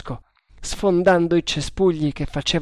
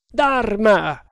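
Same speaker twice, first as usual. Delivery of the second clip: high call to an animal; shouted call; 7 semitones higher, 4 dB louder than usual. A narrator's reading. Master calling his tiger, shouted. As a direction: cry out the name, pitched high. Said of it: "gridò Tremal Naik".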